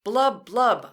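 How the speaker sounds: loud blub blub; bubbling blub. Shown as a demonstration. Teacher bubbling like a submarine, loud. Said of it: "your turn to make the sound".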